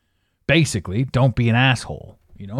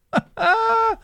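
high-pitched burst of laughter; a short, high laugh. Real, not acted. Podcaster exasperated by lingering spite, high laugh.

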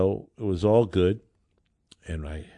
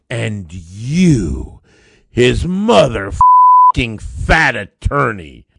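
furious insult; 7 dB louder, much slower than usual. Retold or imitated. Angry woman retold, cursing lawyer.

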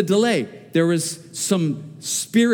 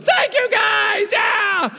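high, loud, stretched thank-you cheer; thanking the officers from the car window, overjoyed shout; joyful shout; 3 dB louder, much slower than usual. Retold or imitated. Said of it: "I felt like I just won the lottery".

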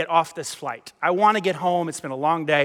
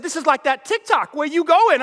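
high, accusing mock yell; yelling mother; 7 semitones higher, 3 dB louder than usual. Mother accusing a passenger, yelling in imitation. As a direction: imitate a mom, louder at the end. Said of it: "she is yelling at me".

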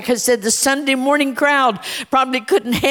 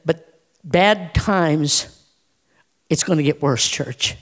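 earnest closing appeal; 7 semitones lower than usual. Real, not acted. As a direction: ask them gently but seriously. Low intimate closing appeal to church.

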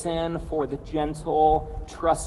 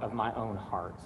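soft, slow prayer on the out-breath; 4 semitones lower, 8 dB quieter than usual. Shown as a demonstration. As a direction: let it fall away with the breath. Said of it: "as you breathe out".

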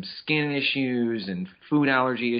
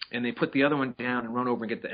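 usual speaking voice

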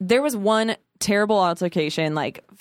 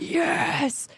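an excited, breathless whispered yes; whispered cheer; 8 semitones higher, 3 dB quieter than usual. Retold or imitated. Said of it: "And I was like".

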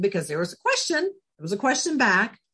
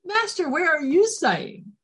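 eager disciple; 9 semitones higher than usual. Retold or imitated. Voicing the disciples' answer.

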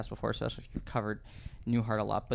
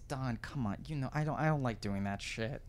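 tired [name], quietly voiced; 8 dB quieter than usual. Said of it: "And he goes".

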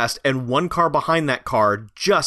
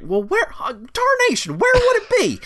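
high, hammed-up hillbilly squeal; mock the clueless moonshiner, way up high. Mocking dumb moonshiners hunting treasure.

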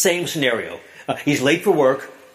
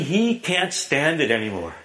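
normal talking voice

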